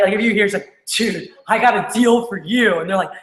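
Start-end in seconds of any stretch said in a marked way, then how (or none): 1.0-2.8 slow, hyped-up deal pitch; Mary Lou. Retold or imitated. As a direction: stretch the words to pump up the rep. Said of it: "they get pumped up".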